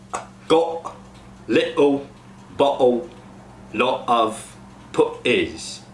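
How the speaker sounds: "got", "little", "bottle" with T swallowed in the throat; glottal stops. Shown as a demonstration. Coach demos glottal stops to cast. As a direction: cut off the T with a glottal stop. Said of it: "go to uh".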